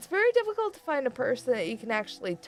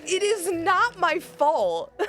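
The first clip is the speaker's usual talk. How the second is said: slow, drawn-out indignant protest; defensive protest; 9 semitones higher, 4 dB louder, much slower than usual. Retold or imitated. Answering the friend who scolded her, slow mock indignation. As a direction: drag it out like an offended kid. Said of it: "I'm just like".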